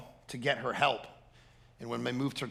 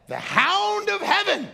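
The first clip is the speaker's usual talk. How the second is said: much higher, louder, slow proclamation; proclaiming; 10 dB louder, slower than usual. Real, not acted. Preacher to congregation naming God, slow loud proclaiming. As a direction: ring it out like a revival preacher.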